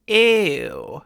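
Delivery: loud, thick, disgusted "ew"; heavy vocal weight, disgusted; emphatic ew. Shown as a demonstration. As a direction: push it out heavy, almost frying. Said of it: "emphatic ew sound".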